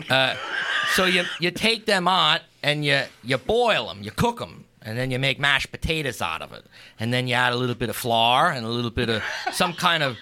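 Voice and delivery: homey, lightly put-on Pennsylvania Dutch accent. Explaining how to fry Fastnachts, mild Pennsylvania Dutch accent. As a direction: tell the recipe like a Pennsylvania Dutch farmer. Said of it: "just a heavy accent".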